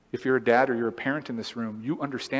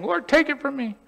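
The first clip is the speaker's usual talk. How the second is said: pleading prayer; 12 semitones higher than usual. Retold or imitated. Believer praying at altar.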